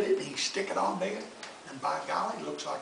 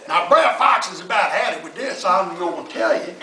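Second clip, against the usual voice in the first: ordinary delivery, nothing marked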